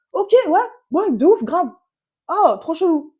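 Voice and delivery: high, loud over-keen agreeing; mock eager agreement. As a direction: go along with it, loudly hammed up. Naively playing along, retold.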